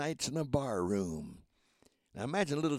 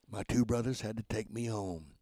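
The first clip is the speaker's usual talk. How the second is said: usual speaking voice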